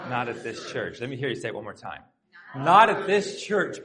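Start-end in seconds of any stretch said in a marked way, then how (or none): 2.5-3.8 loud, slow, higher group-leading call; emphatic rallying call. Shown as a demonstration. Pastor leading congregation to say it once more, emphatic. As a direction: lead the crowd firmly, stretching each word.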